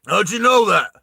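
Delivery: suspicious demand. Acted. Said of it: "still holding it on her face".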